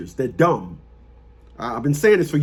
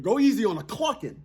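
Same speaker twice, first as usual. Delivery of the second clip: ordinary talk, nothing marked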